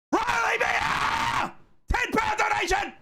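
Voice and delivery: very high, drawn-out scream of the scorer's name; celebratory scream. Real, not acted. Host cheers the scorer and pledges donation, screaming. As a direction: scream it in triumph.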